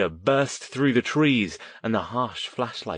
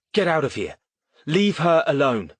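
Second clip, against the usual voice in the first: high, raised shout to drive them off; 7 semitones higher, 4 dB louder than usual. A narrator's reading. Boy yells at the men to leave her alone, high shout. Alistair confronts the attackers.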